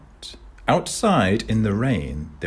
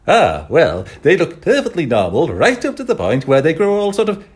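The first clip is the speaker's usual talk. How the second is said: fast, loud, rattling through a list; 10 semitones higher, 10 dB louder than usual. Acted. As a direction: reel it off like a checklist. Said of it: "working through a list".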